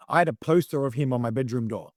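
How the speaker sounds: ordinary talk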